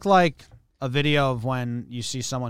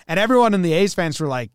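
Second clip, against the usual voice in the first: ordinary delivery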